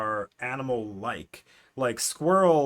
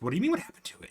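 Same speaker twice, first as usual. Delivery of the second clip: higher, disbelieving retold question; mock incredulous; 7 semitones higher, much faster than usual. Kirky questions news of the missing fish, mock incredulous. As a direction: ask it back in rising disbelief.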